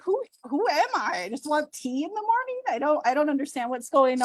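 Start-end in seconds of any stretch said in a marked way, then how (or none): 0.0-2.6 baffled. Retold or imitated. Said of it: "I'm like".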